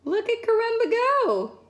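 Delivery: plain reading voice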